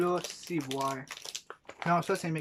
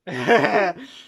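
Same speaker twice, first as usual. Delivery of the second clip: loud laugh; 2 semitones higher, 8 dB louder than usual. Real, not acted. Laughs when handed the mic.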